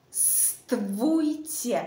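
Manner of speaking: "stvuyte" slowly stretched, swelling on vu; syllable demo. Shown as a demonstration. Teacher repeats for learners, slow "stvuyte". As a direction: draw out "stvuyte" as a separate chunk. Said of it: "Encore une fois".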